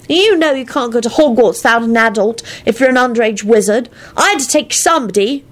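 put-on a kid grumbling about a pest; high child voice, annoyed. Teddy voice.

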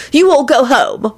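slow, high dismissal; stubborn. A narrator's reading. Woman dismissing the others from the bedside, stubborn high voice. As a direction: send them away in drawn-out high words. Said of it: "she added stubbornly".

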